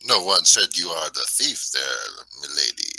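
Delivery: put-on slow, lightly done French accent; a half-hearted French accent out of the side of the mouth. Character telling the Italian girl she's no thief, mock French accent. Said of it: "now I'm talking Italian. Supposed to be French".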